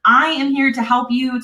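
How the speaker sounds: usual speaking voice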